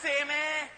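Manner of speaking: "eh" as a rising question; light Trinidadian question eh. Shown as a demonstration. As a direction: ask it, "Same, eh?". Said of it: "Different meaning".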